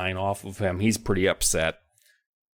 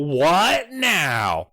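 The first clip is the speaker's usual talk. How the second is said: high, dramatic mock dismay; mock freak-out; 7 semitones higher than usual. Kids freaking out at limping stranger, mock freak-out. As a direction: exclaim it in hammed-up alarm. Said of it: "And they're freaking out".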